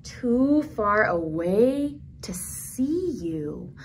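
slow story line fading to a hush on Tatita; storybook reading, grief gently; tender sad reading. Acted. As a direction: let the sadness settle, trail off.

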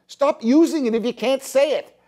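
ordinary delivery

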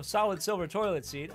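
ordinary talk

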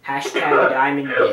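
low, slow repeat; slow it down, drop the pitch, no laughter; slow deadpan. Real, not acted. Player repeating the joke to friends, slow deadpan.

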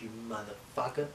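ordinary delivery

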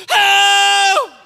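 high, loud wail for help; mock panicked scream. Parent cries to God behind the brave face, mock panic. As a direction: burst into a hammed-up desperate yell.

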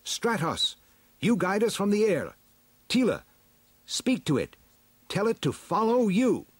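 high, urgent commands; hero giving orders. A narrator's reading. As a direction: call out orders in short, high bursts. Hero gives orders to companions.

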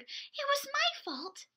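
high, slow apologetic bird; tall bird voice. Acted. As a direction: stretch it out high and timid. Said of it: "said a very tall bird".

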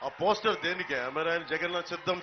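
ordinary delivery

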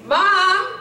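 put-on a child calling into an empty house; mild mock yell into the house. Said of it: "And I remember this one day".

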